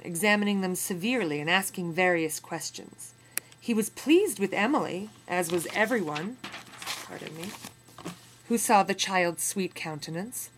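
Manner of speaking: put-on steady, measured reading of an old memoir; story narration. A narrator's reading. Teacher reading a memoir passage to students, in measured narration. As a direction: read the passage to the class with weight.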